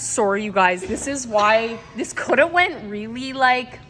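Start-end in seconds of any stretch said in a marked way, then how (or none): none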